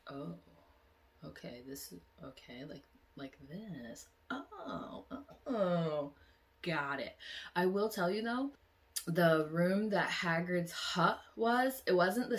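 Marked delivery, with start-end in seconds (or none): 0.1-7.2 much softer, dawning understanding; soft realization. Retold or imitated. Vlogger recalling her morning, soft dawning realization. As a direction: piece it together quietly. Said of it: "to be like".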